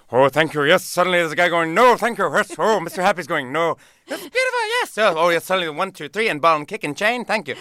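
high, manic stream of switching voices; Robin Williams. Acted. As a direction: race from one excitable character to the next. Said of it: "Robin Williams, I would be like".

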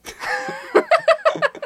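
long real laugh, very high. Real, not acted. After the Hungarian joke, long high real laugh. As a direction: laugh at own punchline.

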